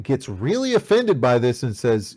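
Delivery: normal talking voice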